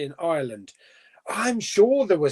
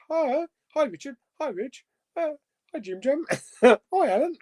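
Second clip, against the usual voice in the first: mock chat greetings; 9 semitones higher than usual. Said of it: "a lot of comments here is".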